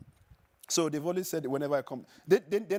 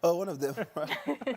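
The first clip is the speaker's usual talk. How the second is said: laughing; 5 semitones higher, 4 dB louder, slower than usual. Real, not acted. Laughing reply about Pulisic.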